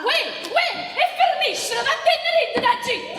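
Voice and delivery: loud and high scolding; shouting. Acted. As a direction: yell at them to stop. Shouting at the other to stop.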